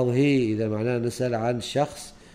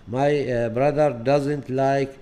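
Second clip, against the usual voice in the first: slow model sentence; 3 semitones higher, 4 dB louder, much slower than usual. Shown as a demonstration. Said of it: "لماذا اخترنا doesn't".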